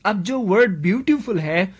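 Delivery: normal talking voice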